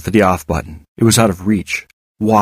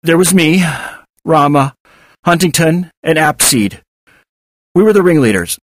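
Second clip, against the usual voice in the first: high, halting delivery; strained answer broken by pauses; struggling answer; 7 semitones higher, 3 dB louder than usual. A narrator's reading. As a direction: force it out name by name. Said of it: "I struggled through my answer".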